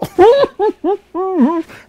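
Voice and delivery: high, loud burst of imitated giggling; laughter bursting out despite trying to hold it in; stifled laughter. Vlogger imitating Dreyfus losing it at the eulogy, stifled laughter. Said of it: "He's trying not to laugh".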